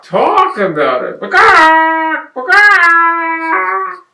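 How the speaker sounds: loud, high chicken squawk with drawn-out swooping words; over-the-top chicken voice, very loud. Acted. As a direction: cluck and shriek it like a fussing hen. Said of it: "What's the chicken gonna do?".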